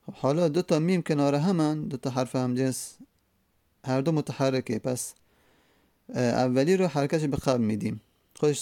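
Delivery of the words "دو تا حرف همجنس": ordinary talk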